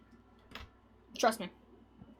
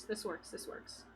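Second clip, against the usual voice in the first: muttered to self; 5 semitones lower, 19 dB quieter than usual. Real, not acted.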